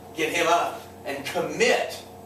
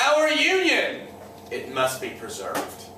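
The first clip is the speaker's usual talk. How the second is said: Jackson; slower than usual. Retold or imitated. Jackson answers the states' rights toasts, slow solemn toast. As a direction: make a deliberate, weighty toast with a long pause. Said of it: "puts his glass in the air and he says".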